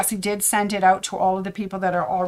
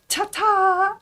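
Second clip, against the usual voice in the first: high, lilting ta-ta; sing-song goodbye; 11 semitones higher than usual. Real, not acted. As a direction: sing it out playfully. Playful goodbye.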